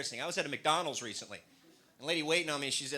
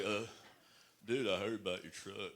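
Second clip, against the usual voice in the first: lower, softer lightly drawled Texan; a hint of a good old boy; Texan guy; 7 semitones lower, 6 dB quieter than usual. Two Texan guys talking about a truck, lightly drawled. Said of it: "I heard these two guys talking".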